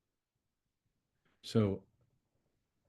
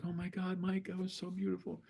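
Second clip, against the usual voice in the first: high, soft imitation of gushing admirers; gushing group praise; 9 semitones higher, 7 dB quieter than usual. Imitating everybody praising Mike after his turn, gushing. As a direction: pitch it up lightly, mimicking an awed group. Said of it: "And everybody's like".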